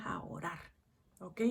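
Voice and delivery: intimate whispered point; whispered emphasis on prayer. Real, not acted. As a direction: whisper it like a secret to the congregation. Stressing key point.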